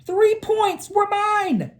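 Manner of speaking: high, slow proud boast; high, slow boyish pride; proud kid. A narrator's reading. Boy's proud line.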